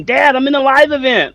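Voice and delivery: put-on high-pitched excited kid voice; imitating excited son. Father imitating son running from his room, excited. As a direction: call to dad in a high, thrilled voice. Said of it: "he was so excited".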